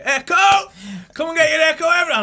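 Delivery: loud high newspaper-seller cry; hawking the Echo, calling out loud and high; street seller's call. Retold or imitated. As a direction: bellow it across the street to sell papers. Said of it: "I started giving it".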